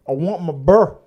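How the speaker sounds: imitating a Southern woman who wants her bear; Southern accent. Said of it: "deep Southern accent".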